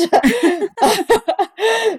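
high, cracking-up laugh; high burst of laughter. Real, not acted. Comic laughing at her own slapping joke.

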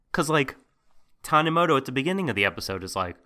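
normal talking voice

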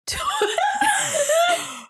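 high, helpless laughing cry; high laughing shriek. Real, not acted. Tripping over the word tourist, high laughing shriek.

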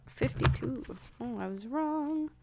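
normal talking voice

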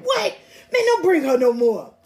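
high, loud, laughing mock outrage; hammed-up angry friends; imitating annoyed fishing buddies with a laugh; mock mad fishermen. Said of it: "Ooh, they were mad".

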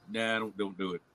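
normal talking voice

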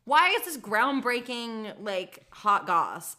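loud, slow, high exasperated disbelief; mock outrage. Friend mocking gossip to cohost, loud slow disbelief. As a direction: drag out the outrage like it's absurd. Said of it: "I'm like".

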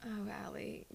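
unvoiced, whispered pity; whispered sigh of pity. Real, not acted. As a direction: breathe it out in fond despair. Sighing over a friend's blunder.

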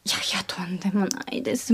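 whispering. Real, not acted. Modest denial, whispered.

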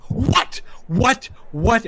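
high, loud, disbelieving repeats; shocked yelps, slightly descending; incredulous outburst. Acted. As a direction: fire off each stunned question separately. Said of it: "and goes".

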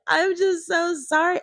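high, slow mock-weepy apology; imitated crying. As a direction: draw out a put-on tearful apology. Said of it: "Teresa's crying and is like".

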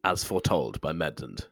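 lightly solemn prophet, low and slow; slow, hushed-down mock proclamation; mock prophecy. Mock prophecy quoted on grid.

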